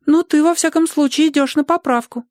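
put-on very high, girlish voice; encouraging little girl. A narrator's reading. Girl reassures the skinny Ogre, high childlike voice.

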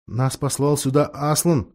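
ordinary delivery

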